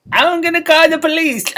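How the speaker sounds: high silly voice. Retold or imitated. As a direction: squeak it out as a joke. Joking reply in high voice.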